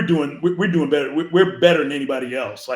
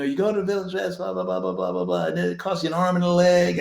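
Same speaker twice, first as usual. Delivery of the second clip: mocking reviewer; 3 semitones higher than usual. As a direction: rattle off a tired complaint. Mimicking a bad online review.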